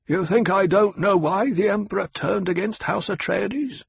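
Hawat, sharp and knowing. Acted. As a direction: lay out the facts to corner him. Hawat taunts.

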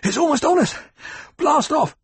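high, loud breathless cry of alarm; panicked gasping shout. A narrator's reading. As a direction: yell it out, gasping, as the worm closes in. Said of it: "came gasping into the rear".

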